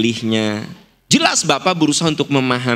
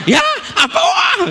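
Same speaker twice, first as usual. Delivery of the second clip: shouted squeal, far higher; mock Pak Kingking. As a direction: ham up Pak Kingking's excited cry. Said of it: "Pak Kingking mah". (menirukan Pak Kingking berteriak melengking)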